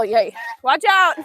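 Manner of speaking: usual speaking voice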